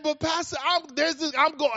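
high, lightly voiced young woman pleading with her pastor; playful high impression of a girl who has her eye on someone; mock young lady. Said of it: "young ladies out there saying".

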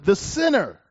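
"the sinner" pressed down with a falling tune; emphasis on sinner. Shown as a demonstration. Preacher reading scripture, emphasising "the sinner". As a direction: set "the sinner" apart with weight. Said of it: "Emphasis added".

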